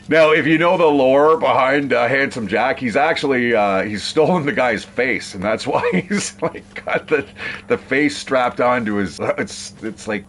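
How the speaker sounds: normal talking voice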